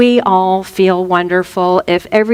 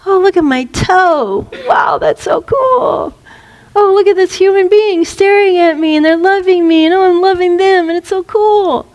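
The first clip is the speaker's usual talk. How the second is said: put-on much higher, wide-eyed baby marveling; 8 semitones higher than usual. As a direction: play a delighted infant discovering the world. Voicing a baby's thoughts.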